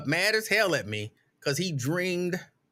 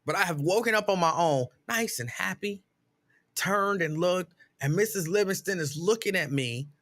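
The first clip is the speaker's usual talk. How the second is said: usual speaking voice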